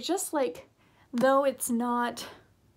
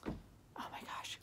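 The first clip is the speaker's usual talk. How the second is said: hushed, breathy whisper; excited whispered gush; whispered aside; 18 dB quieter, slower than usual. Retold or imitated. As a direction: whisper it like a secret thrill. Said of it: "and I was like".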